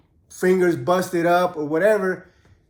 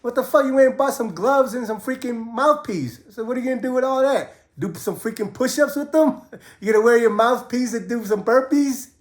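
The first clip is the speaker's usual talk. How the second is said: mock mad; 7 semitones higher than usual. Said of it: "I kinda got mad".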